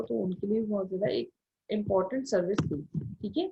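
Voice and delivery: ordinary talk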